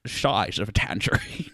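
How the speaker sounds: mock Scottish film-spy accent; Sean Connery. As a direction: slur s into sh like a Scottish star. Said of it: "Your Sean Connery's pretty good".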